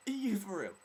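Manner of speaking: very high, loud disbelief; incredulous blurt. Retold or imitated. First reaction to the bad line, incredulous blurt. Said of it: "I just blurt this out".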